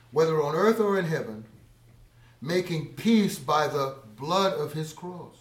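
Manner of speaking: loud pulpit proclamation; preaching loudly. Acted. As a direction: lift the verse to the room. Preaches Colossians aloud.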